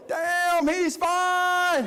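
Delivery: high-pitched mock swoon; comic falsetto admiration, laughing edge; swooning sisters. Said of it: "all the sisters was like".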